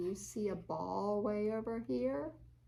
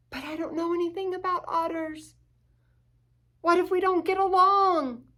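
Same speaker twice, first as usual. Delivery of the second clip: higher and louder, rising alarm; surprised rabbit; 8 semitones higher, 12 dB louder than usual. Acted. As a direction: gasp it out, then fret quickly. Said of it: "Looks like the rabbit's surprised".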